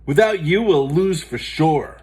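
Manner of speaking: loud, high desperate plea; worried teammate pleading. Acted. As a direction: beg her to play. Buster pleads.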